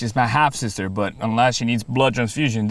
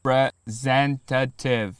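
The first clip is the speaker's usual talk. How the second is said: slow, deliberate to a machine; 2 semitones higher, much slower than usual. Acted. Speaking to phone menu.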